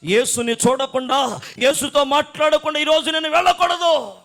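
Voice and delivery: high, loud preacher's cry; full-force preaching. Acted. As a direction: cry out with fervent resolve, stretching line endings. (గట్టిగా, ఆవేశంగా ప్రసంగం)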